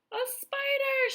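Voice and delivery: high, loud shriek of disgust; shrieking cry. A narrator's reading. Aunt spotting a spider on the ceiling, high shriek. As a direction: scream it out at the spider. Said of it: "she cried".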